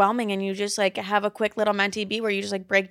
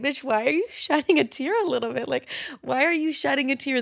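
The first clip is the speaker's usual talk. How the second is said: mocking viewer; 5 semitones higher than usual. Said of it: "and you're like".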